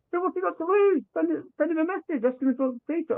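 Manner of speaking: eager urging. Retold or imitated. Friend urging him to message the surfer, excitedly high. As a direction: egg him on, pitch up high.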